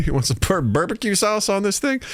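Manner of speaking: high Southern offer of sauce; Southern bumpkin offer. Acted. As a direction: ask it up high with a Southern twang. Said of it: "a bunch of Southern bumpkins".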